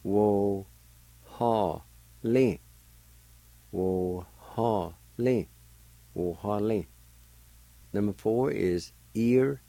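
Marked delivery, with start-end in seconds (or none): 0.0-2.6 Wo and ha held long with pauses, lin short; syllable by syllable. Shown as a demonstration. Teacher showing how "eagle" is pronounced, syllable by syllable. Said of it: "is pronounced like this". 3.7-5.5 slow, drawn-out Wo ha lin with small breaks; syllable by syllable. Shown as a demonstration. Teacher repeating the word for "eagle", slowly by syllable. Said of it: "is pronounced like this".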